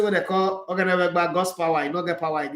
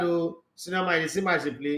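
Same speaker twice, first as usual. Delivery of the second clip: normal talking voice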